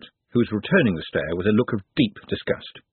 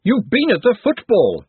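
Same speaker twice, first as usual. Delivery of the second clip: higher, louder sharp retort; snapped; 12 semitones higher, 6 dB louder than usual. Acted. Glenda snaps at Trev over the football. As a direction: snap it at him. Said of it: "snapped Glenda".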